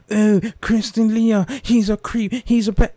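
mocking accuser. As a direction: parody a pearl-clutching critic. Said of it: "Because they're not fucking".

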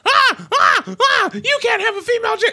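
high-pitched hysterical mimicry of outraged complainers; mock outrage. Host mimicking fans upset at the casting, mock outrage. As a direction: squawk it like someone panicking over nothing. Acting out outraged online reaction.

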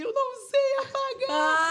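high, loud, slow whine of a helpless man; helpless whiny dad. Retold or imitated. Father helpless about deleting post.

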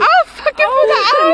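high, slow, hammed-up threat; mock threat. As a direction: overdo the threat in a squeaky voice. Mocking friend's threat.

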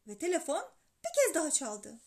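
usual speaking voice

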